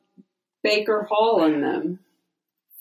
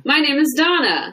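bright, higher host greeting; podcast host intro; 7 semitones higher than usual. Acted. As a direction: introduce yourself on air.

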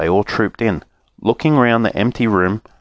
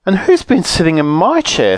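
higher-pitched indignant mother bear; 8 semitones higher than usual. A narrator's reading. Mother bear points to her chair, indignant. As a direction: echo the complaint in a raised voice. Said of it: "said the mother bear".

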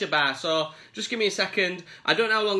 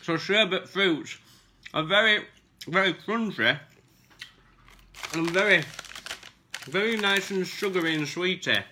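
mouth full. Real, not acted. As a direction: still chewing sherbet sweets. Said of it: "I've just chewed a load of sherbet".

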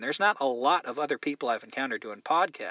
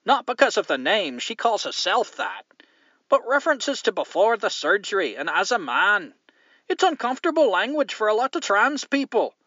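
higher, lightly Scottish reading of a letter; Scottish accent; 7 semitones higher than usual. Retold or imitated. Host reads Keith's complaint aloud in a mild Scottish accent. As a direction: read the letter as a lightly put-on Scot, pitched up. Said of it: "Scottish accent".

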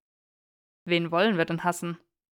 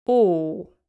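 delighted oh; 8 dB louder than usual. Acted. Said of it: "entzückt".

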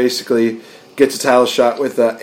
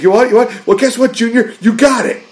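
mocking Jericho; 10 semitones higher than usual. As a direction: taunt him like a smug heel champion. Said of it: "And he's like".

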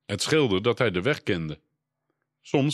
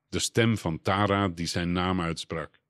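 ordinary delivery

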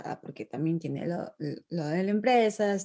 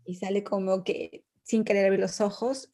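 ordinary talk, nothing marked

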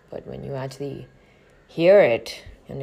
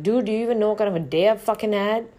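loud, higher, fed-up outburst; exasperated inner shout; 7 semitones higher, 9 dB louder, faster than usual. Retold or imitated. To the guard after a terrible day, loud exasperated outburst. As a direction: let it burst out at him.

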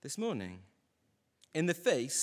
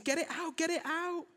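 put-on much higher, wailing little boy; crying toddler voice. Toddler in the bath begging dad to rinse shampoo, high wail. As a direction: whine it like a scared three-year-old. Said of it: "He's crying and he's saying".

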